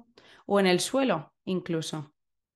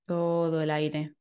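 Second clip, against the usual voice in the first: guided meditation. Acted. Leads a breathing meditation.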